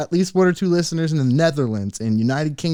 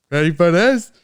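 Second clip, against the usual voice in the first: higher and louder ready for this; hyped up; 6 semitones higher, 8 dB louder than usual. Shown as a demonstration. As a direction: build the hype for the reveal. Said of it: "repeated louder".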